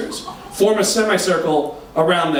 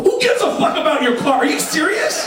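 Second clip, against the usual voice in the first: incredulous; 8 semitones higher than usual. Retold or imitated. Arguing with Jay.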